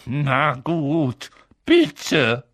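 reluctant, forced politeness, somewhat high; grudging. A narrator's reading. Filch giving in to Peeves, grudging. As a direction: squeeze the word out unwillingly.

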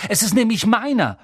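high, loud indignant claim; furious. A narrator's reading. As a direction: angrily assert your right. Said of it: "sagte Harry wütend".

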